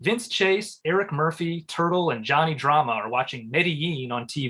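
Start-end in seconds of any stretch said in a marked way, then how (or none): none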